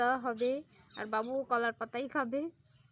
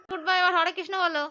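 coaxing call; 3 semitones higher, 8 dB louder than usual. Real, not acted. Urging child to chant.

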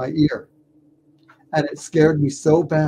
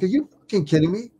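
disbelief; 5 semitones higher, much faster than usual. Retold or imitated. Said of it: "I'm like".

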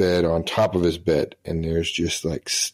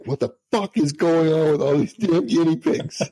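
mock angry yell; 9 semitones higher than usual. Sergeant yelling at the airman about guinea pigs, high mock outrage. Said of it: "he is like".

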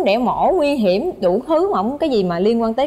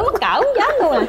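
embarrassed squeal; 11 semitones higher than usual. Retold or imitated. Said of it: "con nói".

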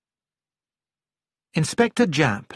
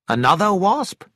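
higher-pitched surprised question; 6 semitones higher than usual. A narrator's reading. Doctor asks about the object on the floor, surprised. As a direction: ask it in surprise, pitch rising.

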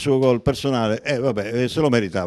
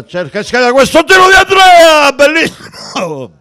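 excited shout; 11 dB louder than usual. Real, not acted. Shot on goal call.

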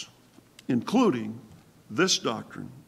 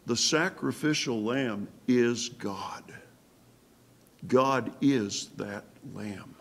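whisper; 3 semitones lower, 6 dB quieter than usual. Real, not acted. Preacher hushes key revelation.

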